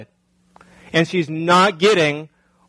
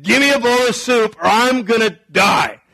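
mock yelling Esau; 5 semitones higher, 4 dB louder than usual. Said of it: "he's yelling and screaming".

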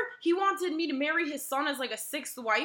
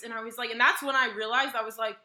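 ordinary talk

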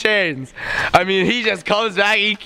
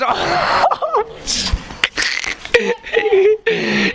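high-pitched burst of laughter. Real, not acted. Laugh at face hit.

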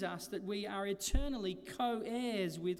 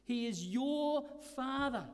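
slow, raised, "your" hit hard; stress on your; 6 semitones higher, 3 dB louder than usual. Shown as a demonstration. Preacher stressing ownership to the congregation, "your" emphasized. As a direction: press "your" in "He is your Father". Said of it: "a real emphasis on the fact that he is yours".

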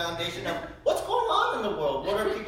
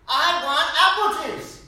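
put-on loud, high, slow toddler whine; hammed-up child demanding "apple juice"; mock toddler insisting at full volume; 12 semitones higher, 13 dB louder than usual. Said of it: "he's like".

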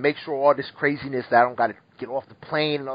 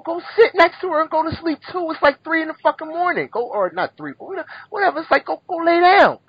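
put-on an exasperated adult snapping at a kid; high mock scolding; 12 semitones higher than usual. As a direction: scold the kid in a much higher pitched voice.

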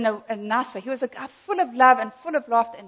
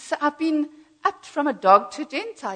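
higher, louder gleeful report; retold happiness; 5 semitones higher, 6 dB louder than usual. Friend telling visitors of his promotion, retold happiness. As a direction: boast of a promotion with glee. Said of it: "I'm so happy".

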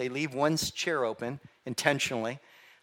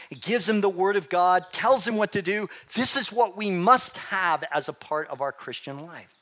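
higher, driving preacher's delivery; impassioned preaching; 6 semitones higher, 4 dB louder than usual. Real, not acted. Preacher urging the congregation to follow Joshua's example, impassioned. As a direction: preach it insistently, pitched up and pressing on.

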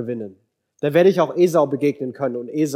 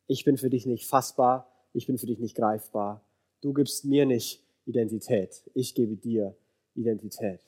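soft, lowered, restrained voice; quiet refusal; 4 semitones lower, 7 dB quieter than usual. Acted. God turns down Jacob's question, quiet refusal. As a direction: refuse gently but firmly, volume held down.

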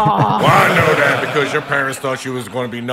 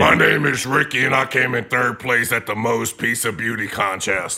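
mocking impression; slower than usual. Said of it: "such good voices".

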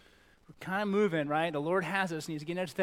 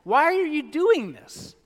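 put-on high, loud, slow kid voice; kid's whine; 12 semitones higher, 13 dB louder, slower than usual. Child confronting his father, high slow whine. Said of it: "So he asked".

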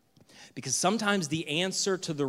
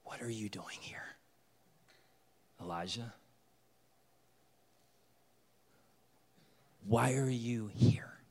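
whispered divine voice; 6 semitones lower, 8 dB quieter than usual. Acted. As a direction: breathe the question like a still small voice. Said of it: "a voice said to him".

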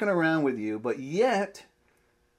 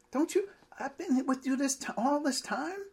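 pitched much higher in pleading disbelief; exasperated Jesus; 13 semitones higher than usual. Acted. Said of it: "Jesus is a bit exasperated here".

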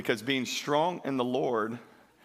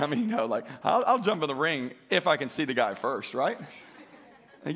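ordinary delivery

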